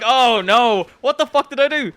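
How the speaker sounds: high, loud mock panic; panicked reaction. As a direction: wail it in comic dread. Acted panic at examiner's reaction.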